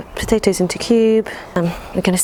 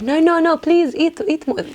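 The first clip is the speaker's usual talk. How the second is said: put-on Arabic accent nan; 9 semitones higher than usual. Nan pressing food on a guest, high Arabic accent. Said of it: "That's an Arabic accent".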